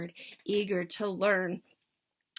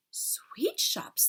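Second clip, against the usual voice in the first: witch chorus; 11 semitones higher than usual. Acted.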